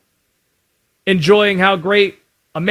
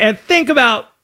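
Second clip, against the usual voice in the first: ordinary delivery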